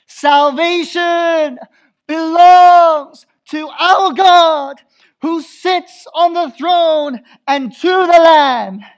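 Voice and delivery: slow, high, very loud proclamation; drawn-out shouted praise; loud cry of praise. Acted. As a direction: cry it out like the multitude before the throne. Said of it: "in a loud voice".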